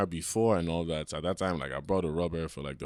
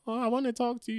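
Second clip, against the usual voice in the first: mock girl voice; 13 semitones higher, 5 dB quieter than usual. Girl voiced in retold story.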